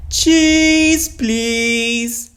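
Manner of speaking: put-on high, drawn-out old rabbit voice; Grampy Rabbit. A narrator's reading. Grampy Rabbit orders cheese, high and drawn out. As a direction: stretch out each word loudly. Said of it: "Grampy Rabbit said".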